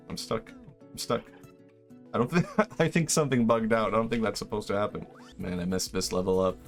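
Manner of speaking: usual speaking voice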